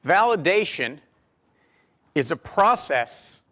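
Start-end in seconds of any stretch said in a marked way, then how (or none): 2.1-3.4 "process" said slowly and leaned on; stress on process. Shown as a demonstration. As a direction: draw out "a process" to drive it home. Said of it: "I wanna emphasize the word process".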